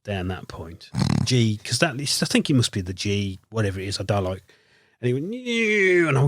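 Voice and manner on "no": high, drawn-out "neeow" noise; long whining swoop in a higher pitch; imitated swoop. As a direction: imitate a helicopter swooping away.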